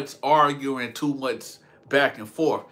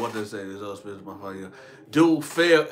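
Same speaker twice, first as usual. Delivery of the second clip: normal talking voice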